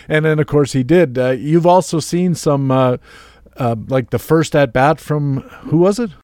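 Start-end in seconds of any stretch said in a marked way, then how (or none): none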